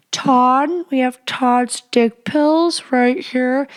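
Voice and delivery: mock pharmacist callout. Pharmacist calling out a husband's pills in line. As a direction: announce it across the pharmacy.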